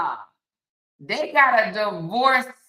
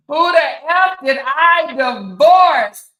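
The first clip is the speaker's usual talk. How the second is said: loud, slow, outraged yell of the question; mock outraged shout; 8 semitones higher, 12 dB louder, slower than usual. Ex-wife fuming over her choices, loud slow outraged shout. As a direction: belt it out like an ex-wife who's fed up.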